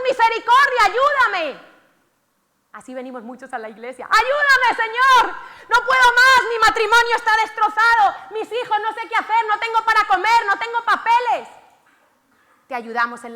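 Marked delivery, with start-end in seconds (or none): 0.1-1.8 loud, higher cry for mercy; desperate shout. Acted. Lepers shouting to Jesus for mercy, loud desperate cry. 4.0-11.5 loud, high, pleading cry; desperate churchgoer plea. Acted. Preacher voicing people who come to church begging God, loud plea.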